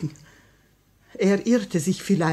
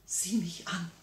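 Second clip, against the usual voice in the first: whispered plea; 11 dB quieter than usual. Acted. Grand duchess whispering to her husband's killer. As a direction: breathe it, no voice at all.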